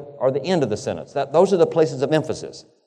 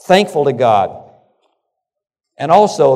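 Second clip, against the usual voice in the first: emphatic preaching; 2 semitones higher, 9 dB louder than usual. Acted. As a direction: press each word from the pulpit.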